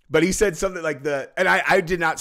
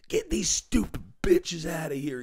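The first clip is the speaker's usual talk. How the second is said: hushed, strained imitation of screaming; mock scream; 3 semitones higher than usual. Retelling an insane guy at a girl fight, whispered mock scream. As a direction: scream under your breath, dragging the words out. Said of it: "screaming at the top of his lungs".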